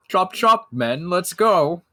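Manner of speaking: high, loud brisk order; pompous rally cry; commanding noble. Acted. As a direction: hurry the men along like a haughty lord. Ambrose rallies the party.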